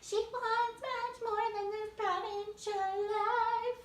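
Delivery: very high, slow sing-song with mangled words; very high, very slow mocking caricature of Belle; mocking Belle impression. Shown as a demonstration. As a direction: sing it cross-eyed as a dim Belle. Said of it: "pretend to be Belle and cross my eyes".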